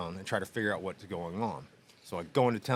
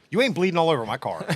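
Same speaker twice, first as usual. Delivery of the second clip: louder, higher, fast irate trooper; hammed-up angry cop, rattled off fast; mock cop yell; 7 semitones higher, 7 dB louder, faster than usual. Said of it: "He goes".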